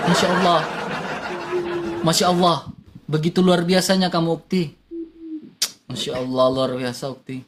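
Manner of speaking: mock ustadz praise.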